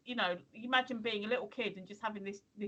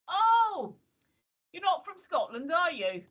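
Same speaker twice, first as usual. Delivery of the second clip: lightly put-on Scottish accent, a little higher; mimicking a shop lad's Scottish voice; 7 semitones higher, 7 dB louder, slower than usual. Shop assistant questions an English customer, mild Scottish accent. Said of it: "In, like, in a Scottish accent".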